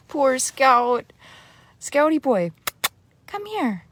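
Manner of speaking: high-pitched pet talk, sympathetic and affectionate; cooing to a dog. Real, not acted. Owner calling her dog who's having a hard time, high cooing. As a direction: coo up high to your poor pup.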